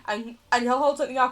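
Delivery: normal talking voice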